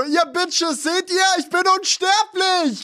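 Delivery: squeaky high villain chicken voice; dark lord. Pretend. (hohe, triumphierende Bösewichtstimme)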